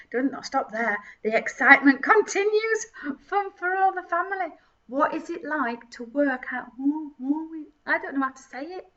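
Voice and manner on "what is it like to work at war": ordinary talk, nothing marked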